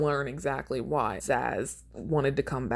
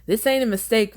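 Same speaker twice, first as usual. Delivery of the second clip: louder, higher taunting voice; menacing inmate; 8 semitones higher, 6 dB louder than usual. Acted. Madman judge taunting Stryver, a hint of menace. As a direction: gloat over the prisoner.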